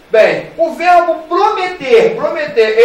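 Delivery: loud classroom announcement; loud announcing. Real, not acted. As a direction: call out the next verb to the class. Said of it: "announcing next verb".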